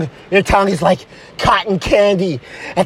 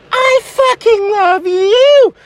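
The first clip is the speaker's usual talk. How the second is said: high, drawn-out lovestruck squeal; slow, tearful-sounding high declaration; gushing girlfriend; 10 semitones higher, slower than usual. Acted. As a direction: stretch it out in a high, swooning voice. Girlfriend answers his declaration, gushing.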